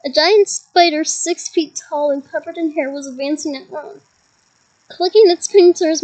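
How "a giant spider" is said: ordinary delivery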